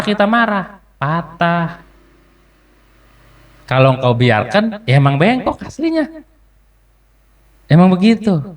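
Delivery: high, lively preacher's voice, half joking; rousing preaching. Acted. Preacher teasing husbands about sulking wives, high and lively.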